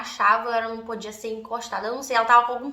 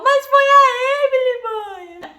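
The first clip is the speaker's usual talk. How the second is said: crying while blaming; 13 semitones higher, 8 dB louder than usual. Retold or imitated. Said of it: "eu chorava e ria ao mesmo tempo".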